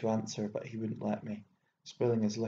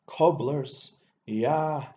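spat out much louder and higher; contemptuous outburst; 4 semitones higher, 8 dB louder, much slower than usual. Acted. Hot-tempered old man bursts out at the young narrator, scornful shout. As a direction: explode with scorn.

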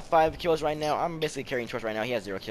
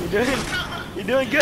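playful check-in; 11 semitones higher, 4 dB louder than usual. Pretend. Same check-in repeated.

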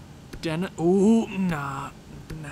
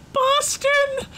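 high, loud laughing shouts, slow and gleeful; ecstatic disbelief; 10 semitones higher, 3 dB louder, slower than usual. Real, not acted. GM celebrating Boston taking the contract, shouting and laughing. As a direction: crow with delight at the other team's blunder.

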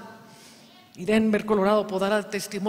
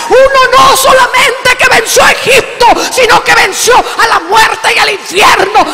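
shouted high fiery sermon; preaching at full force; 9 semitones higher, 15 dB louder than usual. Acted. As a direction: rouse the congregation with every line. Preaching climax.

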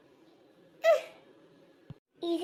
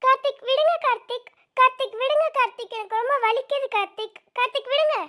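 pained pleading; 6 semitones higher than usual. Acted. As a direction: beg through the pain. Pleading, in pain, being dragged.

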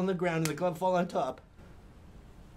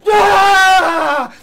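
high, loud scream of fright; retold scream; 13 semitones higher, 18 dB louder than usual. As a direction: let out a startled scream. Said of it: "my scream go through the air".

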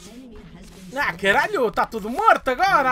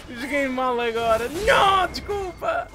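ordinary talk, nothing marked

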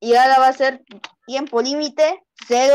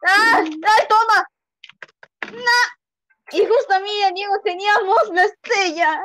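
high yells, then a wail; shrieking whine; 8 semitones higher than usual. Real, not acted. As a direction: shriek and whine about bad luck. Gets hit, loses star. (chillidos y queja aguda)

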